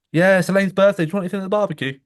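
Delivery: high, loud mock lady voice; imitating the neighbour lady. Retelling the neighbour's barbecue offer, high mock voice. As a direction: put on a bright neighbour asking over the car window. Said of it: "in my conscious, I'm thinking".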